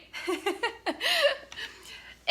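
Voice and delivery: high bright laugh; laughter. Real, not acted. Vlogger laughs at her own magician act, high laugh.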